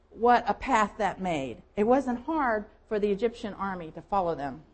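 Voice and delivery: ordinary talk, nothing marked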